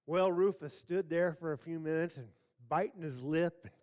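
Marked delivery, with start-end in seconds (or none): none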